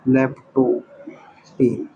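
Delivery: low, soft, very slow syllables; slow dictation. Real, not acted. Teacher rewriting the corrected term, slow dictation. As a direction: sound it out while writing, gaps between parts.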